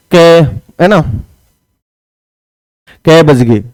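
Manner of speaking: casual Haryanvi asking the time with कै; Haryanvi kai. Shown as a demonstration. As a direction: say कै the rural Haryanvi way. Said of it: "हरियाणवी में हम ऐसे ही बोलते हैं".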